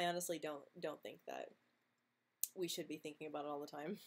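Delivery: usual speaking voice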